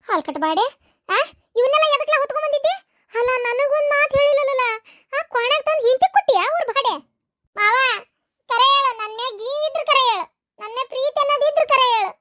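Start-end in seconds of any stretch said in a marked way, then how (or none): 1.6-12.1 scolding mother-in-law. Acted. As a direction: scold your son shrilly for bringing food. Mother-in-law scolds her son. (ಗದರಿಸುವ ಅತ್ತೆಯ ಧ್ವನಿ)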